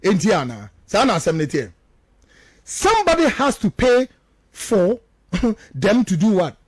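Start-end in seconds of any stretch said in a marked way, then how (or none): none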